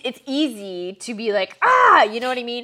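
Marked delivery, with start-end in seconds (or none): 1.6-2.0 loud high frustrated cry; sudden exasperated yell; frustrated shout. Retold or imitated. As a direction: blurt out exasperated. Frustrated character outburst.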